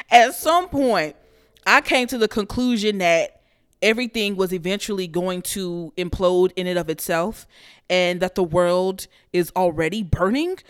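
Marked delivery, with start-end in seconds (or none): none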